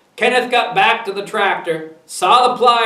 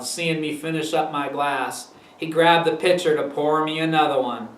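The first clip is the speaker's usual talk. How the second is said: ordinary delivery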